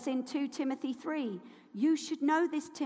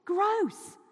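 a high, disgusted exclamation; 6 semitones higher than usual. Real, not acted. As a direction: pull a face and say it. Reacting to dead bones.

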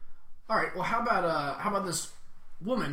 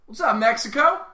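loud shout-out; 8 semitones higher, 13 dB louder than usual. Real, not acted. Shout-out to Mexican listeners.

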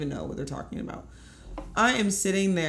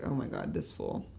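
exasperated mutter; 12 semitones lower, 7 dB quieter than usual. Retold or imitated.

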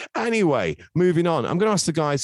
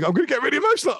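much higher, hammed-up gush; mock emotional; 4 dB louder, much faster than usual. Recalling the Mull of Kintyre moment, hammed-up excited gush. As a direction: play up the swell of feeling, half mocking. Host retells his own thought.

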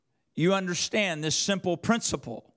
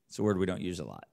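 normal talking voice

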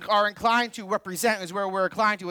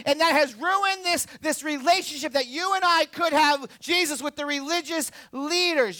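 full-force preaching; 7 semitones higher than usual. Acted. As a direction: preach it out hard to the crowd.

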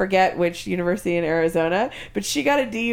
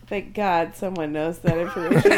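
ordinary talk

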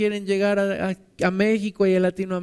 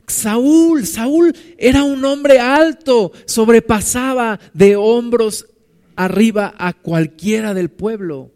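louder, slower, pressing each word; preaching loud; 3 semitones higher, 7 dB louder, slower than usual. Real, not acted. Preacher lifting his voice to the congregation about Saul. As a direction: build it up with force. Preacher builds on Saul's stature.

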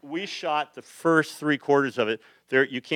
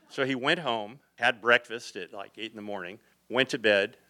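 ordinary talk, nothing marked

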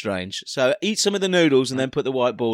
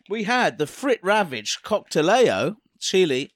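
normal talking voice

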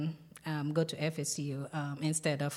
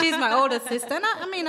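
louder, higher, laughing; 8 semitones higher, 7 dB louder, faster than usual. Real, not acted. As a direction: laugh while explaining. Laughs about her sister.

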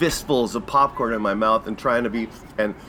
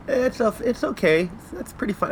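put-on unimpressed kids; 8 semitones higher than usual. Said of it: "they were always like".

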